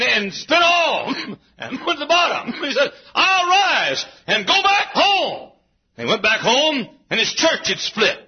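fervent revival preaching. Acted. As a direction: build the sermon to a cry. Preaching at full force.

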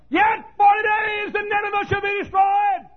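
high, loud street-preacher shout; prophet's doom shout. Acted. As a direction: cry the warning out across the city.

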